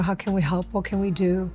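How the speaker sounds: ordinary talk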